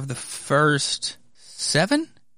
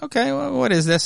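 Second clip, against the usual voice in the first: puzzled discovery; 11 semitones higher, faster than usual. Retold or imitated. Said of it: "So I was like".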